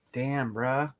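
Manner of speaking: broad rural Queensland Damn, bruh; thick Bogan accent, quiet; Australian Bogan twang. Shown as a demonstration. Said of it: "I have a deep Bogan accent".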